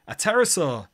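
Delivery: high, loud storybook exclamation; startled exclamation. A narrator's reading. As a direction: exclaim it in amazement, pitched right up. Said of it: "you exclaim".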